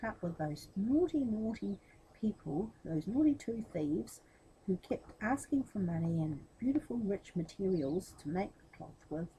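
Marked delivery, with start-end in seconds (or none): none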